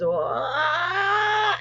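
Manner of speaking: long, climbing imitation of a creature's scream; imitated blood-curdling scream. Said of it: "progressively higher and higher and higher".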